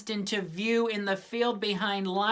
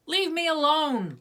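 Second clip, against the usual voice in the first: put-on higher, snappish girl's voice; bossy girl; 10 semitones higher, 3 dB louder than usual. A narrator's reading. Lucy brushing off her brother, higher and snappish. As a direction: brush him off sharply. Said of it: "Lucy said".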